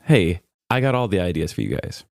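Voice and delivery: ordinary talk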